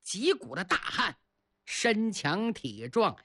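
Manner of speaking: ordinary delivery, nothing marked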